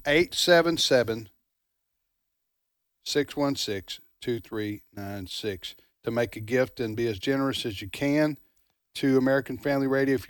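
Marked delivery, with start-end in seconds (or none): 0.1-5.8 slow phone number with long pauses. Real, not acted. Host slowing down the phone number for listeners, slow and measured. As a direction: read the digits lower, in spaced groups. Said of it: "I'll slow down for you folks".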